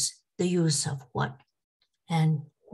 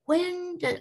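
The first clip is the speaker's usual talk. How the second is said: voice went up; 11 semitones higher than usual. Real, not acted. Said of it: "My voice went up".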